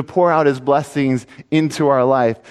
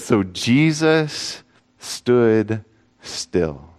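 slow dramatic reading; 4 semitones lower, much slower than usual. Acted.

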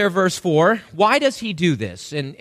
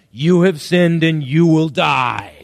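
loud, booming verdict from the law; thundering pronouncement; 3 semitones lower, 5 dB louder than usual. Acted. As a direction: thunder it like a death sentence. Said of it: "It thunders from Mount Sinai".

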